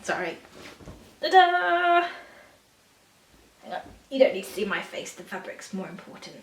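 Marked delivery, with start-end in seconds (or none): 1.2-2.1 high, loud, sung-out fanfare; triumphant reveal. Real, not acted. Vlogger unveiling Christmas fabric to viewers, triumphant. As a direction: unveil it with a big flourish.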